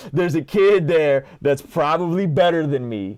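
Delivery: high, loud laugh running into the words. Real, not acted. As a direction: laugh through the line. Laughing at his own analogy.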